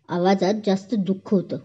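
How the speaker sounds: ordinary delivery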